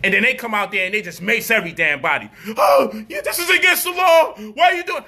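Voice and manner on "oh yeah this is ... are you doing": mock protester whine. Mocking protesters being maced.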